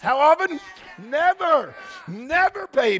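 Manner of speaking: high, emphatic call and response; said with your chest. Shown as a demonstration. Pastor leading the congregation in a call and response, emphatic and high. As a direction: belt NEVER out with conviction. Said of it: "say it with your chest".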